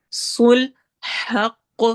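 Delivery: صلحق with a bouncing echo on the final q; صلحق, heavy q with qalqala echo. Shown as a demonstration. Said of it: "قلقلہ ہوگا".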